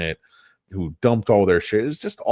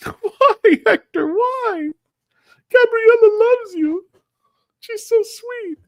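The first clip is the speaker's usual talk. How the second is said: much higher, louder, laughing mock sob at the TV; overplayed weepy fan begging a character; mock tearful pleading; 7 dB louder, slower than usual. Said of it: "I'm crying".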